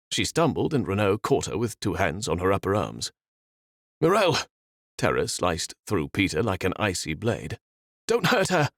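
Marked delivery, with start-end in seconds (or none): 4.0-4.4 high, loud cry of her name; terrified shout of her name; cry of alarm. Acted. Peter cries out in terror. 8.1-8.7 high, loud desperate plea; terrified shouted plea; frightened plea. Acted. Peter pleads in terror.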